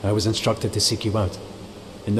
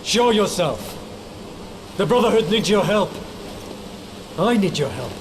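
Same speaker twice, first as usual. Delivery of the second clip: shouting across the water to summon a hidden spirit; calling out; 11 semitones higher than usual. Acted. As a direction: call out to someone hidden, demanding an answer. Calling out to unseen guardian.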